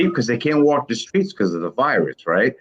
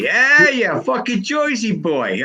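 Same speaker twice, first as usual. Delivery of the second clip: a quick tease in a put-on New Jersey accent; mock Jersey accent; 9 semitones higher, slower than usual. Ribbing Tim about Jersey in a mock New Jersey accent. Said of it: "notice the accent there".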